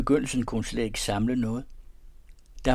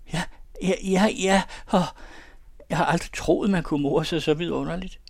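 high, louder, breathless delight; gushing; 8 semitones higher, 5 dB louder than usual. Acted. Girl telling the young man how happy she is, high and gushing. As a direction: pour out the joy in a high rush.

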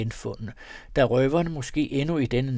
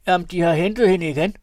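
higher, louder and finer woman's voice; a more refined speaker answers; 6 semitones higher, 8 dB louder, faster than usual. A narrator's reading. Woman inside answering in a finer voice. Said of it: "med en anden og finere stemme".

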